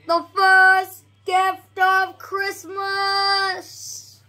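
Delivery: high, loud and slow, half sung; grand call. Acted. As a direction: proclaim it to the cheering elves. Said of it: "called out".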